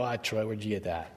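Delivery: mock a listener's doubting question, low and soft; skeptical listener. Preacher imitates a doubting listener, low and soft. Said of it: "And you go".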